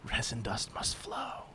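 slow unvoiced whisper. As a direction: breathe it like a prophecy. Parody proclamation, whispered.

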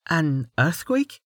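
a curious knight wondering aloud; Amelia, puzzled. A narrator's reading. Amelia voiced, puzzled question.

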